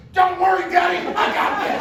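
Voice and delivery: put-on very high, loud child voice; high-pitched kid calling to dad; little daughter. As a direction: shout up like a confident little girl. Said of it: "My daughter looks up and goes".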